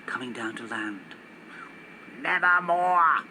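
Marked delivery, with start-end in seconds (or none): 2.2-3.2 slow, drawn-out croaking raven. Acted. Raven impressed by the landing planes, croaking slowly.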